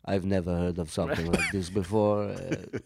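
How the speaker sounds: low imitation of dad's Israeli-Romanian accent; disappointed dad's accent. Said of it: "It's the accent of disappointment".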